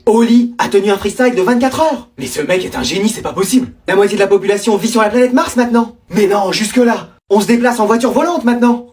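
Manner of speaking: ordinary talk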